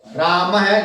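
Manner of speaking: राम है said "raa-ma-ha"; visarga echoing A. Shown as a demonstration. Teacher repeating spoken form of रामः, ending "ha". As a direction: end राम है on an echoed "ha". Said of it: "बोला ऐसे जाएगा".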